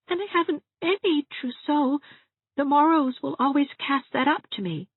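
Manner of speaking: high, whimpering whine; tearful Dovey, complaining. A narrator's reading. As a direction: whine self-pityingly near tears.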